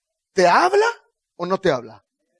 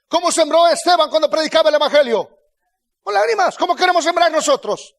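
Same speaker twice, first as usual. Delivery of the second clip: insistent, rapid-fire rhetorical questions in a raised voice; full-force preaching; 6 semitones higher, 5 dB louder than usual. Real, not acted. Preacher asking how the prophets sowed, fervent preaching. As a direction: press each question home to the congregation.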